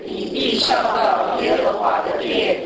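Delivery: slow, solemn Bible reading voice; scripture reading. Acted. Preacher reading God's promise to Hezekiah, slow and solemn. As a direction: read God's words slowly and with weight.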